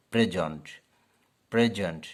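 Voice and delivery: প্রেজেন্ট as noun or adjective, stress on the start; noun present. Shown as a demonstration. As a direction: stress on the first syllable of প্রেজেন্ট. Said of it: "noun এবং adjective হয় তাহলে অবশ্যই".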